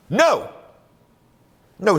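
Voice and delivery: sharp high emphatic no. Real, not acted. Candidate flatly rejecting injection sites, emphatic high no. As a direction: shoot the idea down with one high word.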